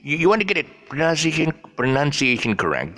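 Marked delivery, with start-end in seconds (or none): none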